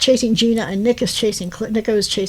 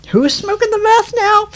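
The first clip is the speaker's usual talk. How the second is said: mock gotcha; 10 semitones higher than usual. EMT's gotcha question.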